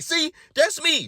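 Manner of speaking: high, slow proud see, that's me; proud excited claim. Retold or imitated. Patient points at a player, high slow proud claim. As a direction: point at the screen like a star.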